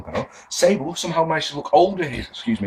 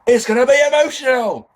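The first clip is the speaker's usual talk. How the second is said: high, loud hammed-up catchphrase; mock shout; 11 semitones higher, 5 dB louder than usual. Mocking Jones's line in context here, high mock shouting. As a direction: blare it out absurdly high. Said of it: "In context here".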